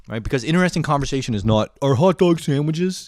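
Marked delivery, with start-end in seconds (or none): 1.8-3.1 higher, slow, silly asker; mock dumb question. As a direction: drawl it out like a pointless question.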